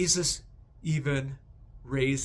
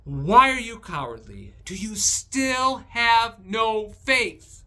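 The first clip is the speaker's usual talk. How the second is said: louder, slow, disappointed rebuke; rebuking disciples; 7 semitones higher, 7 dB louder than usual. Retold or imitated. Jesus rebuking his cowardly disciples, slow stern scolding. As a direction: scold them, dragging out the second question. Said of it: "and rebukes them".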